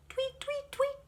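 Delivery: very high chirping; bright birdsong imitation; bird chirps. Birds singing.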